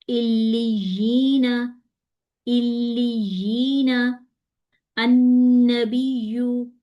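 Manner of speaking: drawn out, chanted tajweed reading; slow Quranic recitation. Shown as a demonstration. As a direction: recite slowly, holding the doubled ya. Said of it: "ये हमारी ये sound है".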